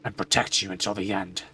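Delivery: a weary, grieving father; somber father voice. Acted. Father character speaks again.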